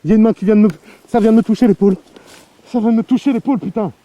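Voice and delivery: loud, high, terrified outburst; panicked. Real, not acted. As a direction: blurt it out in real fright. A hand touched his shoulder.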